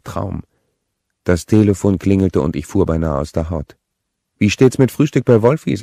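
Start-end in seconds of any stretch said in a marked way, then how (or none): 4.4-5.8 higher, louder sister inviting him to breakfast; Deborah asking. A narrator's reading. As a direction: lift up and call out the invitation. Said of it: "erkundigte sich Deborah". (Deborah lädt zum Frühstück ein, höher und lauter)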